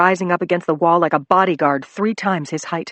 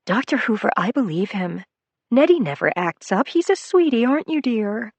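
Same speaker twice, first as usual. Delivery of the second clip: higher, sweet motherly nurse voice; kindly nurse; 6 semitones higher than usual. Acted. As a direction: coo it over the patient.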